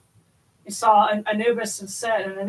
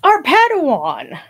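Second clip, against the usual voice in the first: playful; 5 semitones higher, 8 dB louder, slower than usual. Real, not acted. Teasing nickname for caller.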